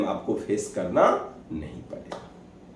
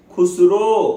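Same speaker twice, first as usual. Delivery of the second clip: louder teacher prompt on the name; teacher drilling; 6 semitones higher, 6 dB louder, much slower than usual. Retold or imitated. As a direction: call out Khusro clearly for the pupil to repeat. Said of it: "तो उसको ऐसे बताते थे".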